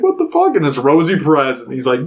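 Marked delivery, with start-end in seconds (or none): 0.0-0.5 high-pitched startled exclamation; mock shock. Cab passenger spotting his sister-in-law, mock shocked. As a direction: blurt it high, imitating disbelief.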